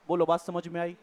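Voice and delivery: ordinary talk, nothing marked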